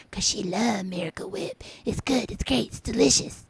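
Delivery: mock whisper. Mocking the cook's love of Miracle Whip, hushed gush. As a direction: whisper it like a secret treat.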